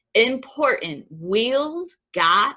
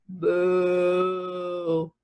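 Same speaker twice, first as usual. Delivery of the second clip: long, held moo; drawn-out steady cow sound; 2 semitones lower than usual. A narrator's reading. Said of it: "Cow said".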